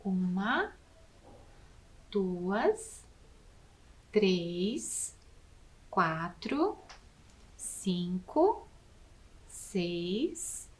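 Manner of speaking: each number drawn out with a rising tune, soft; slow counting. Shown as a demonstration. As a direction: count uma, duas, três slowly so the class joins. (contagem lenta com a turma)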